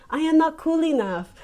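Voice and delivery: put-on quoting the School of Rock pianist in his accent; imitated accent. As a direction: mimic the pianist's shy accent. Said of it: "He has an adorable little accent".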